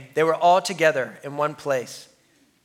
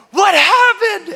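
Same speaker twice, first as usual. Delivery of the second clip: high, loud and slow; startled shout across the water; alarmed question; 9 dB louder, slower than usual. Retold or imitated. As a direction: call out startled in the dark. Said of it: "And Brant is like".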